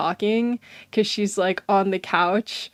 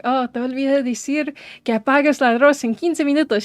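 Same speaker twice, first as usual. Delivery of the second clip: higher, louder phone reminder in Spanish; imitated mom; 5 semitones higher, 7 dB louder, faster than usual. Mom phoning child about the rice, lightly imitated. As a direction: lightly voice a hurried parent remembering something. Voices mom's forgotten Spanish instruction.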